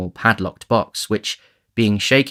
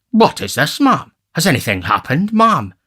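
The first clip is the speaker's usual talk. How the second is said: loud, higher-pitched alarmed questioning; 7 semitones higher, 6 dB louder than usual. A narrator's reading. Beadle stoops over the gasping matron, in loud alarm. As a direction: demand answers in mounting alarm, stammering at the end. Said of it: "in his alarm".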